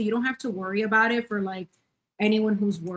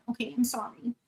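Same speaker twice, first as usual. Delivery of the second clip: usual speaking voice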